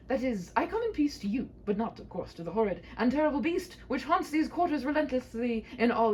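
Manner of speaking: a bold knight calming a frightened maiden; gallant knight. Acted. Knight reassuring the maiden, high and grand.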